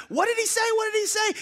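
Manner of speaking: high, eager repeated question; excited pestering. Retold or imitated. Disciples pressing Philip for the answer, high and eager. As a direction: crowd round him, dying to know.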